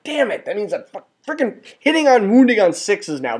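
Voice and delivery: replaying his own panic with a pitch spike; a hammed-up wail of frustration; frustrated outburst. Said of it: "I'm like".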